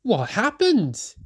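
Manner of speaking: concerned question. A narrator's reading. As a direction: ask with concern. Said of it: "he asked".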